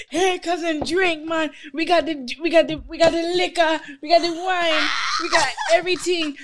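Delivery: high, hammed-up Caribbean cousin voice, laughing by the end; Caribbean relative. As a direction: play a pushy Caribbean cousin pouring drinks. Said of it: "These motherfuckers greeting me like family".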